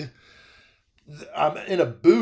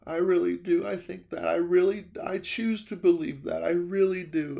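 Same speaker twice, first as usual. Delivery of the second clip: softly voiced widow, slightly raised pitch; tearful old lady; 3 semitones higher, 4 dB quieter than usual. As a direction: imitate her earnest, teary agreement. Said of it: "And she's like".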